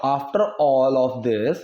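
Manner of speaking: very slow, word by word for learners; slow model reading. Shown as a demonstration. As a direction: spell it out slowly like a teacher. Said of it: "teacher models English phrase". (बहुत धीमे, शब्द-दर-शब्द पढ़ाते हुए)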